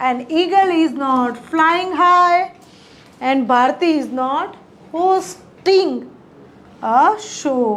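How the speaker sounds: very slow, clear dictation of each English word; teacher modelling English slowly; slow model sentences. Shown as a demonstration. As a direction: spell the sentence out slowly for learners. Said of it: "ચલો વાક્યો જોઈએ મિત્રો".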